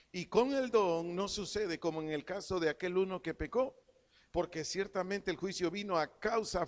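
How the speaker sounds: preacher proclaims the verse, leaning on each phrase; scripture reading. Acted. Preacher reads Romans to the church, emphatic scripture reading.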